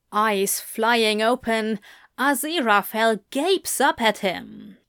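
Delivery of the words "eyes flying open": ordinary delivery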